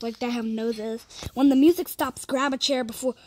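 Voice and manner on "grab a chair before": usual speaking voice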